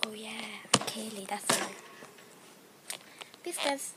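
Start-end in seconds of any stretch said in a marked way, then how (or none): 0.1-1.7 whispered aside. Real, not acted. Whispering her own name to viewers.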